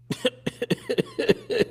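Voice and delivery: sudden high laugh; high burst of laughter. Real, not acted. High laugh at his own joke about the stepfather.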